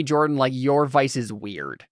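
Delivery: ordinary delivery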